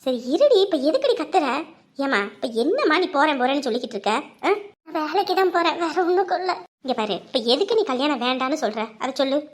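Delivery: low, soft elder voice giving advice; coaxing elder. Acted. Elder coaxing the girl to accept marriage, low and soothing. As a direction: gently lecture the girl with proverbs.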